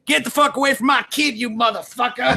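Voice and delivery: high mock yell of an angry mom; hammed-up raging mom; mock screaming mother. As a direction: shriek it like a furious mother. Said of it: "The mother is first seen on the video screaming".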